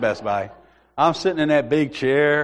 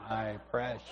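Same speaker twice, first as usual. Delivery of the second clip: much softer, low covetous murmur; Gollum whisper; 7 semitones lower, 18 dB quieter than usual. Retold or imitated. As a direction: lightly mimic the ring-obsessed creature. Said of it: "That's all that guy's life was, that ring".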